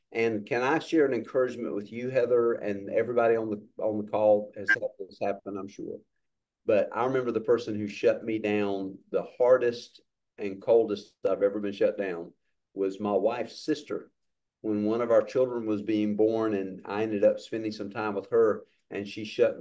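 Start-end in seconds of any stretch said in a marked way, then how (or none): none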